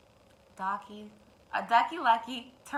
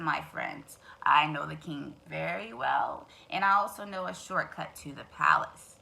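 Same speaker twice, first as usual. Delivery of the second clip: sly fox; 5 semitones lower than usual. Acted. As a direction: coax them toward the shortcut. Fox lures birds.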